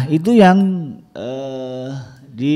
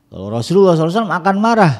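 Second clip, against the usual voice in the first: higher, louder and fast; a burst of preaching fervour; emphatic preaching; 9 semitones higher, 8 dB louder, much faster than usual. Real, not acted. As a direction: drive the point home fervently. Preacher stresses point.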